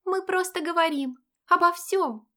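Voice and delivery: put-on high girlish friend voice; chirpy young friend; cartoon princess. A narrator's reading. (высокий девичий голосок)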